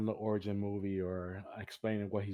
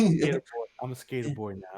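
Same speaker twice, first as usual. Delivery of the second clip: higher mock skater kid; Peter Parker; 4 semitones higher, 7 dB louder than usual. Riffing on the emo Peter Parker, higher teasing impression. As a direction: announce it as a goofy self-declared skater. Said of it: "Peter Parker was like".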